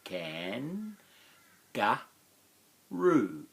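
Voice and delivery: Can, ga, roo, with roo punched high and loud; split into syllables. Shown as a demonstration. Teacher breaking kangaroo into syllables, last beat loud and high. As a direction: break kangaroo into three beats, last one hit. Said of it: "three syllables".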